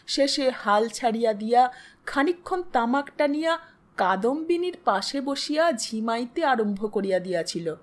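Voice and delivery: ordinary delivery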